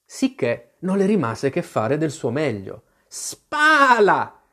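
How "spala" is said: high, loud cry with a long falling vowel; shouting sheep. A narrator's reading. The sheep orders Alice at the oars, high drawn-out shout. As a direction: call out the order as a bossy sheep. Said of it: "gridò la pecora".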